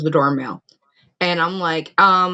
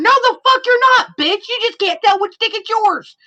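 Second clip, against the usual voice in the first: indignant; 14 semitones higher, 3 dB louder than usual. Real, not acted. Ranting against a story character.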